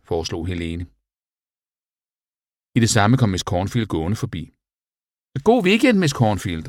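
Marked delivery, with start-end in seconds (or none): 5.5-6.7 high, loud cheery group farewell; called out. A narrator's reading. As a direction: call it brightly, starting high and dropping. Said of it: "råbte de". (højt, lyst tilråb)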